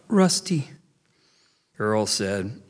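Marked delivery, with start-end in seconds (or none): 0.1-0.7 high tearful choke; choked out. A narrator's reading. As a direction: choke the name through tears. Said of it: "he choked out".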